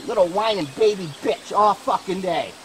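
ordinary talk, nothing marked

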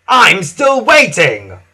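loud, slow impatient huff; Spencer. A narrator's reading. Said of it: "huffed Spencer".

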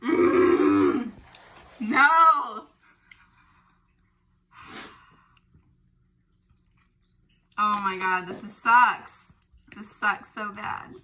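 loud pained groan and cry; pained outcry. Real, not acted. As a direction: cry out from the burn. Burn hits after second taste.

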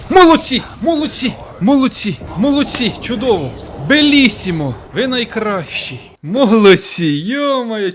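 high cheering shouts of praise; excited cheering. Real, not acted. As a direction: cheer the team on, overjoyed. Cheering allies after the kill.

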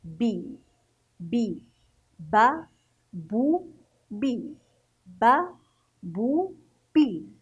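بي با بو chanted one by one, each vowel clear; syllable drill. Shown as a demonstration. Teacher drilling children on fatha, damma and kasra. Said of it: "سنقرأه بهذا الشكل".

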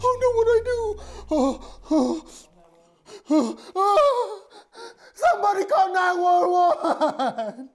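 hysterical screaming. Acted. Emergency hysteria.

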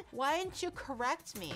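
usual speaking voice

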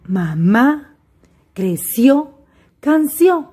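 mamá, creció, canción, each leaning on its last syllable; final-syllable stress. Shown as a demonstration. Teacher gives examples of agudas, final-syllable stress. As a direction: list mamá, creció, canción one by one, weight on the endings. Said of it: "Las palabras agudas se acentúan".

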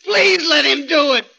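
very high, sobbing and shouting please; crying plea. Acted. Gorgo begs Petrov through tears, crying out. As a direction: break down begging.

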